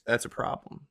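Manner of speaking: whispered, under the breath; hushed serious aside. Real, not acted. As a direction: drop to a whisper to make the point. Aside about family faith.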